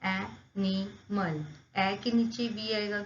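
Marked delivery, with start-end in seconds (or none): 0.1-1.4 "animal" slowly split as a-ni-mal; "animal" broken into three drawn-out beats; syllable by syllable. Shown as a demonstration. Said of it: "three syllables can be shown as".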